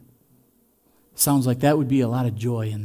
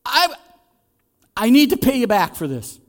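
high, loud gushing thanks; overjoyed gift receiver; 9 semitones higher, 9 dB louder than usual. Acted. Receiver thrilled by the gift, gushing loudly. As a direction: gush over the gift like it's the best ever. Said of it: "and they go".

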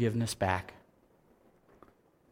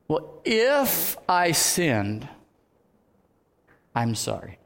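proud non-apology; 3 semitones higher, much slower than usual. Shown as a demonstration. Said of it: "don't project pride".

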